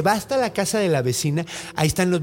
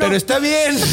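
high mock dumb neighbor; 10 semitones higher, 4 dB louder than usual. Neighbor asks dumbly.

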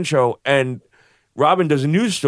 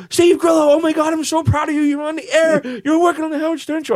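high, gushing proud-friend voice, much higher; excited greeting; 13 semitones higher than usual. Retold or imitated. Bouncer proudly greeting his old drinking buddy, high and gushing. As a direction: gush with pride at an old friend.

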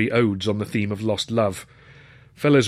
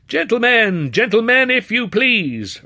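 loud, high bellow over a crowd; 12 semitones higher, 7 dB louder, slower than usual. A narrator's reading. Master of ceremonies bellows at the rowdy crowd. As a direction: bellow to hush the room. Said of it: "Browse bellowed".

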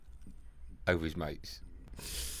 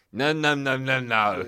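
emphatic South African agent refusing; louder run of refusals in a mock South African accent; 6 dB louder, slower than usual. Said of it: "I'll do the accent".

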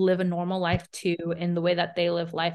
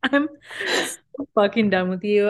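slow, half-crying through laughter; fake-weep while cracking up; laughing mock despair; 3 semitones higher, 4 dB louder than usual.

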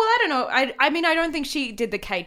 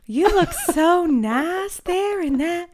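slow, high, stretched Southern drawl; flirty Southern; 6 semitones higher, much slower than usual. Acted. Stanley's friend flirting with Blanche in bath, slow Southern drawl. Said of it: "could flirt in that voice".

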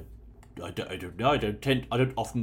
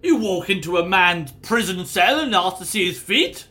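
indignant; 8 semitones higher, 11 dB louder than usual. Acted. Prisoner indignant at being searched.

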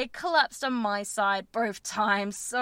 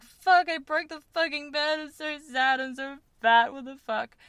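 high, tearful wail of self-pity; mock bawling; 5 semitones higher, 4 dB louder than usual. Retelling to listeners her tearful wail at 5 a.m. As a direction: act out sobbing over the broken bed. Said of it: "I'm bawling my eyes out".

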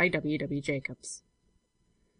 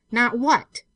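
fierce demand; 6 semitones higher, 7 dB louder than usual. A narrator's reading. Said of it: "turning upon him fiercely".

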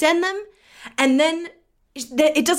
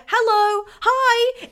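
high, anxious mock calling; retold freaking out; 11 semitones higher, 3 dB louder, much slower than usual. Seller chasing a silent buyer, mock freaking out. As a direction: call out higher each time, badgering for a reply. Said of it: "I'm freaking out".

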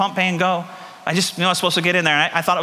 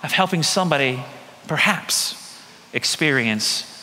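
preaching climax, building then fading; slower than usual. Acted. Pastor telling his church about the gift beyond words, swelling then hushed. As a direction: swell up with awe, then let it fall to almost nothing.

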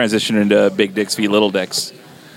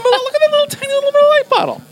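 squeaky cute-struck voice. Real, not acted. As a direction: voice shooting way up high. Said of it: "my voice got, like, three octaves higher".

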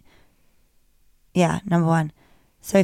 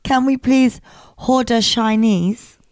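measured, exaggerated Nigerian accent; mock Nigerian accent. Shown as a demonstration. Host demonstrating accent hack, mock Nigerian accent. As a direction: put on a Nigerian accent, word by word. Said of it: "this is the Nigerian".

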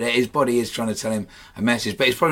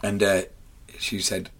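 usual speaking voice

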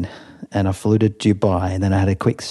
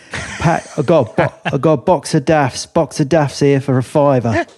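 higher Cockney market cry; Cockney accent; 6 semitones higher, 3 dB louder than usual. Retold or imitated. Cockney trader selling daffodils at market, Cockney accent. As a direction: hawk your wares like a Cockney flower seller. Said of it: "some of the accents".

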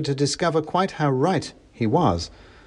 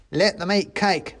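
mock soldier shout; 8 semitones higher than usual. A narrator's reading. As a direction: call it out as the little soldier. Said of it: "shouted the soldier".

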